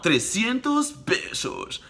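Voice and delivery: high, slow, hammed-up Spanish price call; Dominican vendor. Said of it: "I was saying that to everybody".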